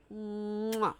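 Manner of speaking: exaggerated "mwah" smooch; kiss noise. Streamer kissing a game character, playful smooch sound. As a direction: plant a big audible kiss.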